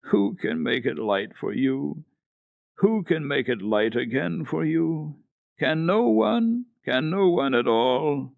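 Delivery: high, slow, pleading wail; a hint of a weeping little girl; sobbing child. A narrator's reading. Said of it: "she kept sobbing".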